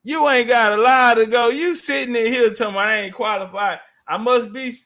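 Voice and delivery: usual speaking voice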